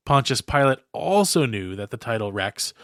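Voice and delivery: ordinary delivery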